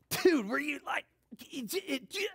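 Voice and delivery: high, stammering, can't get the words out; too excited to talk. Retold or imitated. Yankees fan in a bar meeting someone who was there, high excited stammer. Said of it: "He was so excited".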